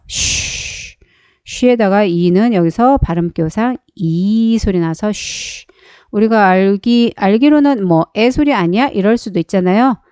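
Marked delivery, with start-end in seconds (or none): 0.1-1.0 쉬 as a long voiceless hush; isolated sh sound. Shown as a demonstration. Teacher answers what sound SH makes, 쉬 voiceless and soft. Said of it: "에스에이치가 무슨 소리 나죠". 5.1-5.7 쉬 as a long voiceless hush; isolated sh sound. Shown as a demonstration. Teacher answers what sound SH makes, 쉬 voiceless and soft. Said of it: "에스에이치가 무슨 소리 나죠".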